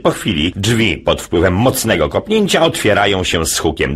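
ordinary delivery

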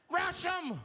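high, loud rallying yell; rally the bus to charge; mock battle cry, high and loud; screamed out. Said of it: "He screamed out".

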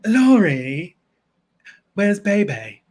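drawn-out, affected diva call; Moira Rose. Acted. Impression for Lori, affected diva calling out. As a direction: stretch each name theatrically. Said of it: "Moira would say".

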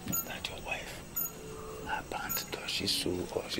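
hushed, secretive whisper; whispered question. Acted. Friend asks about his wife's underwear in a sly whisper. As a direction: lean in and whisper the gossip.

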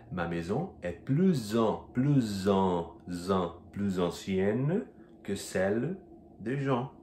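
plus ancienne, slow and linked, plooz; slow, stumbling liaison plus ancienne. Shown as a demonstration. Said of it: "quando ele tem som de Z".

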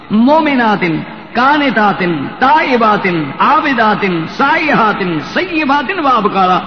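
preacher calling out the list with force; emphatic preaching. Acted. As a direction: proclaim each word to the congregation.

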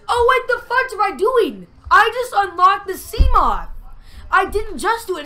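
very high, loud outburst of sudden realisation; excited shouting. Real, not acted. Gamer who just unlocked the Seamoth, excited shouting. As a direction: shout it in high-pitched disbelief.

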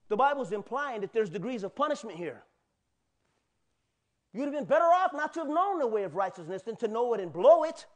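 loud, high-pitched sermon delivery; forceful preaching. Real, not acted.

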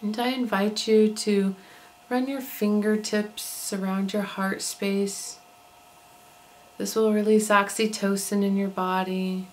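calm, unhurried meditation voice with long pauses; soothing body-scan narration, evenly paced; guided meditation. Acted. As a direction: guide listeners gently into relaxation, leaving space between phrases.

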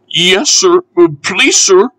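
high, hesitant pleading voice; nervous. A narrator's reading. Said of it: "said Percy nervously".

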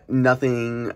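normal talking voice

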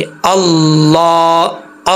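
अल्लाह very slow, with "laa" held long; drawn-out, steady अल्लाह; long heavy "laa". Shown as a demonstration. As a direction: join into the doubled L of अल्लाह, then sustain the vowel. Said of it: "इसको अल्लाहुम्मा नहीं पढ़ेंगे".

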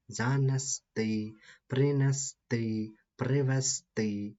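teacher drilling the stressed ending; stress on the last syllable. Shown as a demonstration. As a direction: hit the final syllable of each word. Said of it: "наголос завжди на «і»".

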